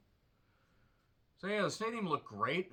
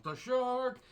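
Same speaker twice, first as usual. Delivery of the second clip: rising finish; 9 semitones higher than usual. Pretend. Repetition ends high.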